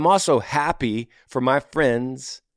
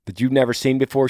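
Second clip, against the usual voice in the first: ordinary talk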